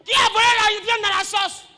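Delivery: loud, high, accusing father's shout; angry father. Acted. Preacher voicing a father demanding who stole the meat, shouting. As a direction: demand an answer like a furious dad.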